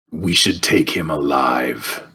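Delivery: low, slow in-character voice; deep, deliberate delivery to a party member; in-character speech. Acted. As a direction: say it low and drawn out, as a game character. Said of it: "I'm gonna try to say to, um, to Chris".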